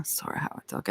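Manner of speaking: soft unvoiced whisper; whispered apology. Real, not acted. A tarot reader whispering sorry to the viewer. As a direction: breathe the apology out with no voice. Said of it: "I don't even talk relaxingly".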